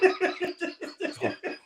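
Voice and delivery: high burst of laughter; real high-pitched chuckling. Real, not acted. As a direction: laugh at the punchline. Laughs at own story's punchline.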